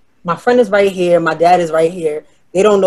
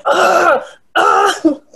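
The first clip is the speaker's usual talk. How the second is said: mock grunting; 11 semitones higher than usual. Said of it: "and she's like".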